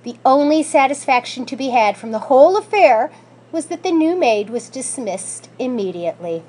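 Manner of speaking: ordinary delivery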